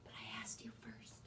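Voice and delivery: whispered, sly aside. Acted. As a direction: whisper it like a secret. Dog's cheeky postscript.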